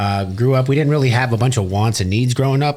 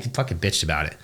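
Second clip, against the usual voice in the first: normal talking voice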